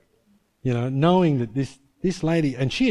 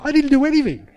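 much higher, pleading denial; high protest of innocence; 12 semitones higher, 4 dB louder than usual. Retold or imitated. Chaplain protesting to the principal, high innocent denial. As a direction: squeak out the denial.

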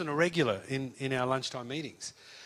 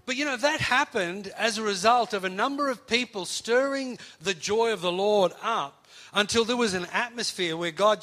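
higher, louder preaching build; 6 semitones higher, 7 dB louder than usual. Acted. Preacher drives home the lesson to the congregation, fervent. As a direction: lift the room with fervour.

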